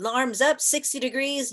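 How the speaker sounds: loud, firm class command; teacher's command. Retold or imitated. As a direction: bark it like a yoga teacher. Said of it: "If the teacher says".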